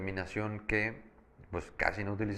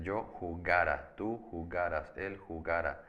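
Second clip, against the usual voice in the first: measured jugara, jugaras, jugara, each leaning on GA; stress on GA, not the ending. Shown as a demonstration. Teacher drilling words without written accent, stress on GA in jugara. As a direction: keep the final syllable of jugara and jugaras unstressed. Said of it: "no llevan acento".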